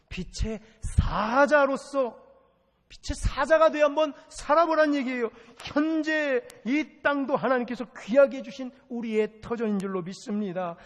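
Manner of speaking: loud, high, exhorting sermon voice; full-force preaching. Real, not acted. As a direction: drive each exhortation home from the pulpit.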